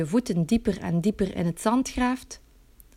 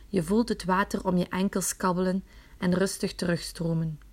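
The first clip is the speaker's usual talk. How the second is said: ordinary talk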